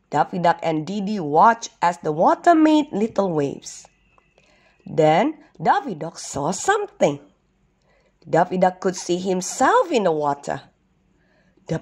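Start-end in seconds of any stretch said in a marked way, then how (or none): none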